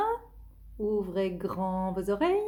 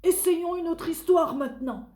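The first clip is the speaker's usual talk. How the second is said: put-on child voice; 7 semitones higher, 5 dB louder than usual. Tony decides.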